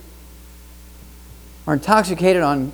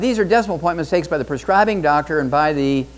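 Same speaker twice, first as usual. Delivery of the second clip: ordinary talk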